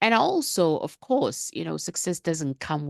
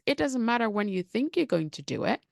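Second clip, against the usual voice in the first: normal talking voice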